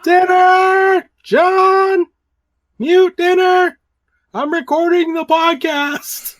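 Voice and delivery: shouting across the house; calling out. Real, not acted. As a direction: yell to someone in another room. Calling out to family mid-recording.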